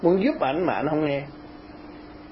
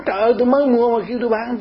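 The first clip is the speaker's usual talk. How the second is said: quick, higher-pitched mock objection; retold protest; 7 semitones higher, 4 dB louder, faster than usual. Owner objecting to selling what he just bought, protesting. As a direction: protest rapidly, imitating an indignant buyer.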